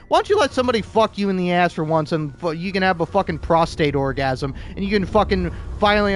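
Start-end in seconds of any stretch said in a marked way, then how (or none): none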